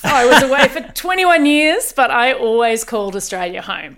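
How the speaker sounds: ordinary talk, nothing marked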